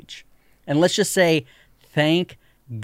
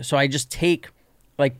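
usual speaking voice

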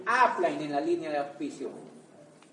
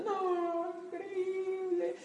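mock swooning fan; 12 semitones higher, 3 dB quieter, much slower than usual. Quoting crowd's excited reaction.

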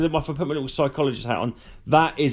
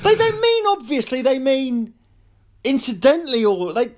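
mocking; 11 semitones higher, 3 dB louder than usual. Mocking how people say obviously.

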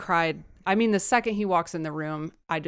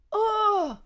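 high, drawn-out pretend cry; whimpering high "oh"; mock wail. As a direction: wail playfully over the sad story. Mock crying over the sad line.

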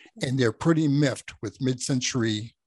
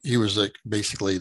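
usual speaking voice

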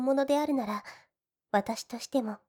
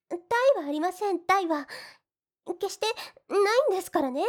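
higher, defensive tsundere denial; flustered insistence; 5 semitones higher, faster than usual. Acted. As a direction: protest a little too much. Heroine insists no ulterior motive. (ツンデレ風に慌てて否定)

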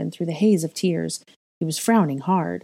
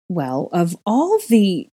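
slow, affronted huff; indignant huff; 2 semitones higher, 4 dB louder, slower than usual. A narrator's reading. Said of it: "Gryffindor huffed".

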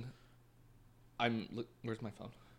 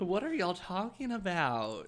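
slow Southern drawl; slow, higher Southern accent; 8 semitones higher, 3 dB louder, slower than usual. Shown as a demonstration. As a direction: drawl it slowly like a Southern belle. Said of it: "that was part of the Southern accent".

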